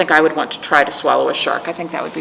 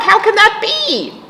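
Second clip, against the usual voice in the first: much higher mock-astonished aside; mock amazement; 13 semitones higher than usual. Storyteller to the kids, higher mock surprise. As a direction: gasp at the page in pretend disbelief.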